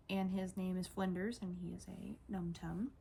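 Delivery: ordinary talk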